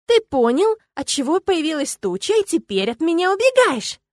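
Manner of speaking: high, loud, heated accusation; worked-up outburst at being avoided; accusing exclamation. A narrator's reading. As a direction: cry out your accusation, voice climbing. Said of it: "воскликнула я".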